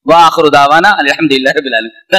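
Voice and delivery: fast closing prayer tossed off; rushed closing formula. Shown as a demonstration. Preacher rushing a mock closing of a speech, fast. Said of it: "تقریر ختم".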